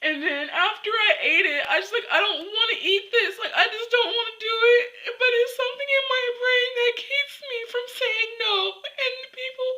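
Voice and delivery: crying while talking, high-pitched; real crying. Real, not acted. Admitting to viewers she can't say no, crying. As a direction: push helpless words out through tears. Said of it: "Okay, I'm gonna cry".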